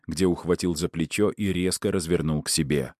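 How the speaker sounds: ordinary delivery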